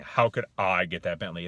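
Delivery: loud question that expects no answer; disbelieving tone. Shown as a demonstration. Coach showing a pretend question, disbelieving. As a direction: pretend to ask, mind already made up. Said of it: "pretending like you're looking for an answer".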